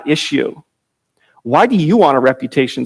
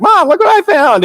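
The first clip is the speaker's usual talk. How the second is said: put-on much higher, eager child's call; a kid running in to show his find; excited boy Reuben; 3 dB louder than usual. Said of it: "he comes back and he's like".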